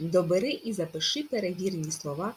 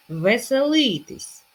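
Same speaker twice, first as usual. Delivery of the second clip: "Веселитись" slow, loud, high on ли; "Веселитись", punching the first и; stress on first и; 5 semitones higher, 7 dB louder, slower than usual. Shown as a demonstration. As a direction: stretch ли in "Веселитись". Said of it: "Наголос на першу и".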